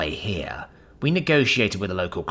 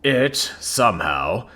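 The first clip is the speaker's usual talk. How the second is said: booming; 8 dB louder than usual. Acted. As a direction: command the room, booming. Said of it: "he booms".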